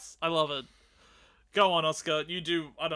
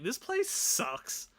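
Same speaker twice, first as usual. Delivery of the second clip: put-on bratty kid; 2 semitones higher, 5 dB louder, slower than usual. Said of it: "a kid rocks up ... is like".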